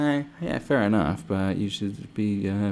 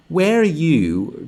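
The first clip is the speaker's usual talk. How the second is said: louder, higher, slow demanding yell; ranting shout; 9 semitones higher, 10 dB louder, much slower than usual. Real, not acted. Ranting at virtue signallers in a slow, loud yell. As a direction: draw it out like a furious challenge.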